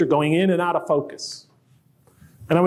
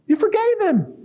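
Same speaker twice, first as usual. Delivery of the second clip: much higher, pleading indignation; indignant inner voice. Retold or imitated. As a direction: protest like a wronged child. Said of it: "I thought".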